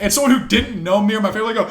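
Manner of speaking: usual speaking voice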